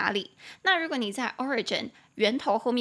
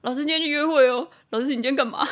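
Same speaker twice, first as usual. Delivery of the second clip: playful put-on chorus of students, breaking into a laugh; teasing students; 3 semitones higher, 6 dB louder, faster than usual. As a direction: imitate students nosily teasing their teacher. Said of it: "学生就会说".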